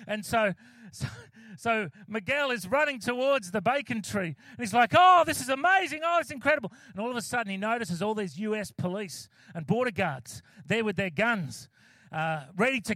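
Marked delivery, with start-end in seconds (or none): 5.0-6.7 high, loud thrilled gasp of delight; excited. Acted. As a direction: gush over the bacon tree like a kid. Miguel runs to bacon tree.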